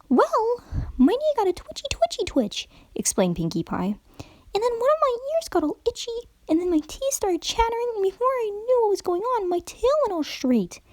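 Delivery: very high, bubbly cartoon pony voice; giddy high pony explaining her twitches; hyper Pinkie Pie. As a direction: chatter it like an excitable party pony.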